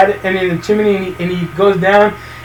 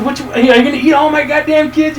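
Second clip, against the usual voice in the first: higher-pitched mock outrage; pissed off; 7 semitones higher than usual. Retelling the dad berating the kid-eating zombie, mock pissed off. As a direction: act the fed-up dad, voice pitched up. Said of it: "pissed off".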